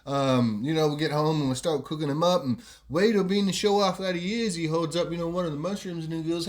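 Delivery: ordinary talk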